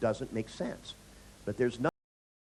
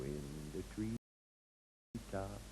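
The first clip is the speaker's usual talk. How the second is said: very soft, very slow drawn out; tender hushed sing-song; soothing lullaby; 4 semitones lower, 11 dB quieter, much slower than usual. Retold or imitated. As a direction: croon it gently to sleep. Soothing the sleepless client.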